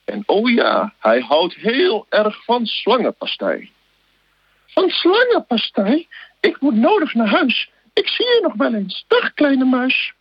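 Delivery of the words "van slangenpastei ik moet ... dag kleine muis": very high, slow, squeaky snake hurrying off; a scared snake making its excuses; high squeaky snake backing away; frightened snake voice. Acted. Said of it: "Echt fantastisch met al die stemmetjes".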